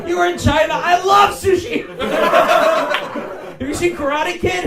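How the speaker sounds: high, loud over-eager dad; hammed-up clueless enthusiasm, nearly shouted; excited dad. Comedian's dad on the phone about China, hammed-up loud excitement. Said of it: "That's just my dad".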